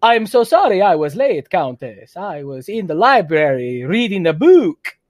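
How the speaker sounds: mock Transylvanian Dracula accent; hint of a Dracula accent, hammed up for a school play. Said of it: "say all your lines with an accent".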